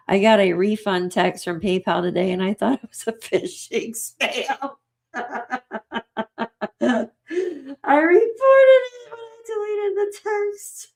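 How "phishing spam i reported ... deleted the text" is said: high squealing laugh-talk; helpless laughter. Real, not acted. Streamer telling chat about a refund text, helplessly laughing. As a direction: barely get the words out through high-pitched laughter. Cracking up over a scam text.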